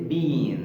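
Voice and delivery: "bean" with a long ee, the British way of saying been; British-style long-vowel "bean"; British pronunciation. Shown as a demonstration. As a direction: stretch the ee in "bean". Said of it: "في البريتش accent بتتنطق bean".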